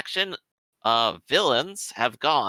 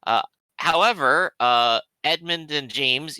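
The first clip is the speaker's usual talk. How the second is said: ordinary delivery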